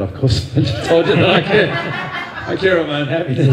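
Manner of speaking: chuckling at his own contradiction; self-mocking laughter about caring for his own happiness; laughing. Real, not acted.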